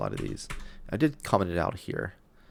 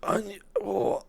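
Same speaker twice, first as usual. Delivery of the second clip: a startled high yelp of realisation; sudden high cry; 12 semitones higher than usual. Real, not acted. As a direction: cry out as the mistake dawns.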